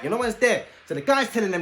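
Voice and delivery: ordinary talk, nothing marked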